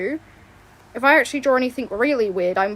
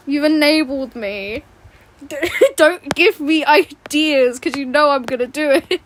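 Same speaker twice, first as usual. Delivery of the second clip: laughing; 3 semitones higher, 8 dB louder than usual. Real, not acted.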